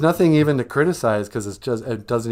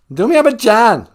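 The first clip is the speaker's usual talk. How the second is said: very loud, high-pitched declaration; abrasive; 9 semitones higher, 10 dB louder than usual. Shown as a demonstration. Repeating the Bengali for a puzzled listener, abrasive. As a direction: shout it abrasively. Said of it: "Not so abrasive".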